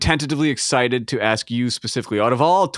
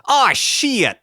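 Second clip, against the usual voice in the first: high-pitched mock cry of pain; stubbed-toe curse; 12 semitones higher, 3 dB louder than usual. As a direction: curse as if you just stubbed your toe. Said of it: "what happens when you stub your toe".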